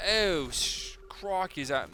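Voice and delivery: a quick mock Australian exclamation; Australian accent. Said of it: "that's, like, Australian".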